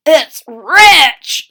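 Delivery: soldier voice. Acted. Second soldier tasting the soup, loud. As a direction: proclaim it loudly. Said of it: "said the second".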